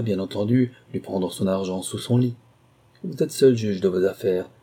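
ordinary delivery

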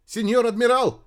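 high, loud alarmed call; cried out. A narrator's reading. Returning radio operator calling the admiral, crying out. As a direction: call out urgently to a superior. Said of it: "закричал вернувшийся радист".

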